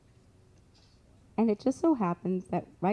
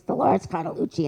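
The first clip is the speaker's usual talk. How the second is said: imitated Italian psychic's accent; 3 dB louder than usual. Storyteller imitates the Italian clairvoyant's way of talking, Italian accent. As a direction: say the name with a hint of Italian accent. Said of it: "that's how she talked".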